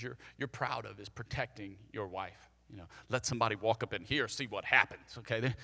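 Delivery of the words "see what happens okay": ordinary delivery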